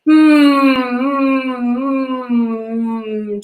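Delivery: forward buzzing hum; hummed mm. Shown as a demonstration. Teacher humming for students with buzzing lips. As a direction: let the lips tingle on the hum. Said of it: "tingling sensation in the front of the lips".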